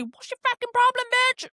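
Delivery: high-pitched indignant challenge; acted-out angry comeback; confrontational. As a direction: snap it back as an imagined insult. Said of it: "as if to say".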